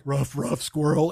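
low, gruff mock dog bark; lightly voiced dog going ruff; a hint of a dopey dog. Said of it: "your dog was like".

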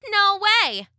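high cheeky refusal; cocky little cookie voice; gingerbread man. A narrator's reading. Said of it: "said the gingerbread man".

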